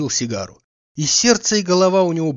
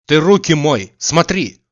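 louder, sharp scolding at the servant; spiteful; 3 semitones higher, 8 dB louder, slower than usual. Acted. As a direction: scold him for the dirty hands, angrily. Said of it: "злобно сказал Обломов".

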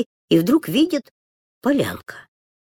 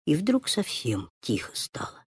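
plain reading voice

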